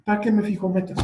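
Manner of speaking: high, whiny scoff at setting goals; mock discouraged whine. Imitating a quitter who mocks setting goals. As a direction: whine it like someone who gave up. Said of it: "Porque además te dicen".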